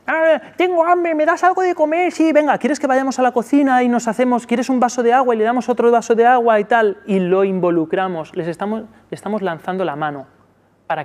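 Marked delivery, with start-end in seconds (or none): 0.1-2.1 put-on whiny child. Shown as a demonstration. Child voice asking for food. 2.1-7.0 cheerful parent. Shown as a demonstration. Parent answering the child.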